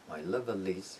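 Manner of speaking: "My lovelies" run together, the L starting the last syllable "liz"; final L revived by the following vowel in "My lovelies"; linked final L. Shown as a demonstration. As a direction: link level into is, as "My lovelies".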